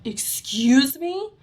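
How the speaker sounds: acted-out affronted shock; incredulous. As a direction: draw it out, unable to believe what you heard. Retold disbelief at five months news.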